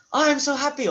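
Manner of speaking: high, loud gush of Oh, I'm so happy. Shown as a demonstration. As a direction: burst with joy on Oh, I'm so happy. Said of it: "not through the".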